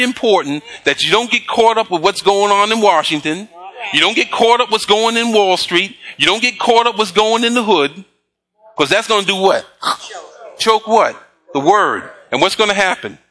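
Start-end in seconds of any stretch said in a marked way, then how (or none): none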